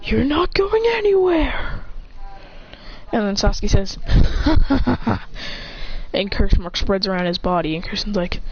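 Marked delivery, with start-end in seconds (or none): 0.0-1.8 high, slow strained threat; mock anime fighter's warning; acted threat. Character threatens opponent. 4.0-6.1 staccato acted evil laugh; mock villain's laughter.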